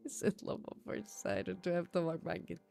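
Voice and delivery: breathy whispered rerun of the joke. Pretend. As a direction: whisper it, amused.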